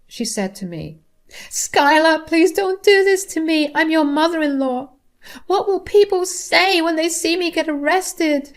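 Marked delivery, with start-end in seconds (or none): 1.5-8.5 high, loud desperate pleading; begging mother-in-law. Acted. Mother-in-law being arrested begs her daughter-in-law, high and pleading. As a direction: beg for mercy in a high strained voice. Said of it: "She screamed and cried while begging".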